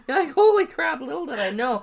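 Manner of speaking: loud, high and laughing; excited exclaim. Real, not acted. Dramatic emphasis, laughing.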